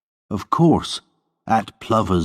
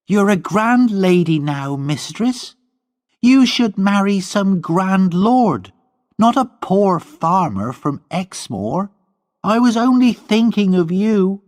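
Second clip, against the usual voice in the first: put-on much higher servant girl; defiant high servant voice; Gwenny's voice; 13 semitones higher, 3 dB louder than usual. A narrator's reading. Gwenny defending herself to Lorna, much higher voice.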